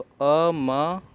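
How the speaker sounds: अ म sounded out slowly, apart; syllable by syllable. Shown as a demonstration. Teacher sounding out अ म for students. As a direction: split अ म into separate sounds. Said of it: "इसे हम म".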